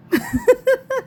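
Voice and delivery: high, rapid chuckling; laugh. Real, not acted. Vlogger showing her dog's toys, high giggling laugh. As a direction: break into a high-pitched giggle.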